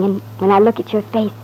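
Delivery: usual speaking voice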